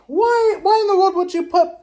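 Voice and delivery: high, loud, near-crying why; pleading with Brian Kelly in disbelief; exasperated outcry. Real, not acted. Questioning the coach's choice.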